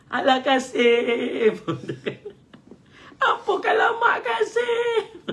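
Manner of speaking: high, loud, laughing mock whine; whiny wailing voice. Preacher mocks a loud whining supplicant, high laughing wail. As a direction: ham up a wailing complaint. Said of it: "jangan berteriak".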